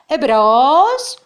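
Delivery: loud, high phone greeting; calling out. A narrator's reading. Girl answers the phone, calling out loudly. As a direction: shout it into the receiver. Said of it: "φωνάζει".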